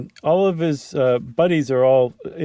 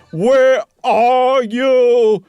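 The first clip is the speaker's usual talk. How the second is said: loud, high, very slow call; 11 semitones higher, 7 dB louder, much slower than usual. Acted. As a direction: stretch it out into the darkness. Calling for Barnes in darkness.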